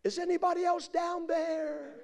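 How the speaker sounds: very high, long wailing holler; hammed-up cry for another rescuer; desperate calling. Man calls for someone else.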